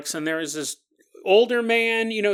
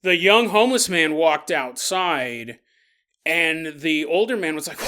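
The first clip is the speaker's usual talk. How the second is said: ordinary delivery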